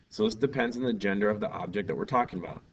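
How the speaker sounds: normal talking voice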